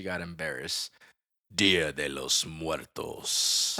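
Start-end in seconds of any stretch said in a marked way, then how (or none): 1.5-3.8 low, very slow Spanish accent; grandly slow, low Spanish delivery; Spanish pronunciation. Shown as a demonstration. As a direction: draw out each word in a deep Spanish voice. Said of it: "said it earlier in Spanish".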